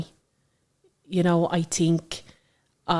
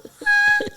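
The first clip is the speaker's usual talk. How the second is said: very high, louder laughing through tears; crying laugh; 6 dB louder than usual. Real, not acted. Mum overjoyed at her daughter naming purple, laugh-crying. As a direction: laugh and sob at once.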